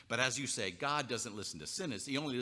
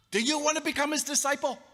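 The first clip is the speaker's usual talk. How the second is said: much higher, louder sneering repeat; mocking taunt; 9 dB louder than usual. Healed man taunting the Pharisees, shrill and loud. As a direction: needle them harder the second time. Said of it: "he was prickly".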